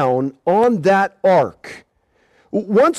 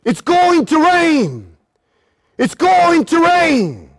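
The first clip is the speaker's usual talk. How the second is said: slow, higher repeated warning; solemn warning; 6 semitones higher, slower than usual. Acted. Preacher warning the congregation, slow and high. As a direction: drive the warning home slowly.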